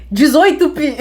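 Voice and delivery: punchline cracking up; angry mom punchline. Retold or imitated. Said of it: "a mãe brava virou assim". (mãe brava de brincadeira, rindo)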